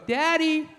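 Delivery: put-on high, drawn-out child's call; little brother calling dad. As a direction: imitate a kid calling for dad. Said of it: "He'd go".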